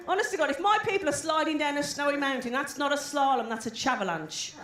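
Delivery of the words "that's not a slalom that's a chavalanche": ordinary talk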